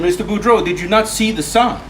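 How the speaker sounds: cop in a joke. Acted. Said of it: "And the cop said".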